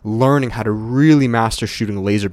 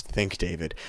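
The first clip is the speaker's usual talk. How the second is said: quiet aside; 3 semitones lower, 9 dB quieter than usual. Real, not acted. Mutters a self-correction aside.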